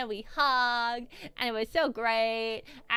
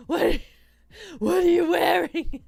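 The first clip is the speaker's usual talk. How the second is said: high, loud laughing question; laughing outburst; 5 semitones higher, 7 dB louder, slower than usual. Real, not acted. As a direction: crack up while asking, louder and higher. Laughing at her unsent message.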